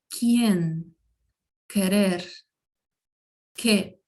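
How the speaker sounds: quien, querer, que with a hard k sound; Spanish qu sound. Shown as a demonstration. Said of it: "Aqui, por exemplo".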